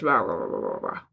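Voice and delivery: low, soft American mumble running together; mock Americans slurring their syllables; under-enunciated American drone, dropping in pitch; mumbled American. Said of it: "But we're Americans, just".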